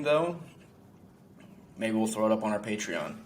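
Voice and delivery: ordinary talk, nothing marked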